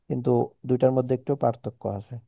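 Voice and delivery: ordinary delivery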